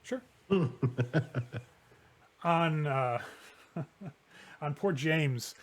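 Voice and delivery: ordinary talk, nothing marked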